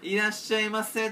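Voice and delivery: a strangely stretched shop greeting; odd clerk's greeting. Retold or imitated. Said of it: "なんかちょっと変わった".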